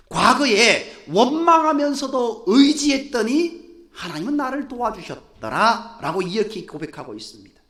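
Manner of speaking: ordinary talk, nothing marked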